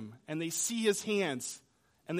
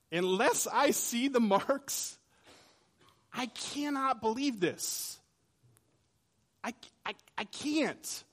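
higher, slow, halting disbelief; stammering, higher voice of a man who cannot believe; doubting Thomas; 5 semitones higher, slower than usual. Acted. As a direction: plead that you can't believe without proof. Thomas voiced doubting his friends.

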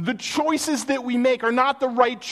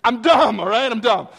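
loud self-mockery; 6 dB louder than usual. Real, not acted. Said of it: "self-mocking emphasis".

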